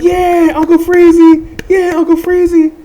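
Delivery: high cheering chant of a nickname; crowd cheer. Retold or imitated. Crowd cheering the player to the bench, high chant.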